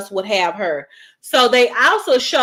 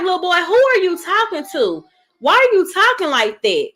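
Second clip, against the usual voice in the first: higher-pitched mock parental scolding; mother scolding; 7 semitones higher than usual. Mother to her little boy who is saying shut up, scolding. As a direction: act out a mother pulling up her child.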